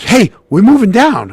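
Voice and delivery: high, loud imitation of an eager friend; excited calling out. Friend runs up with better seats, acted excited call.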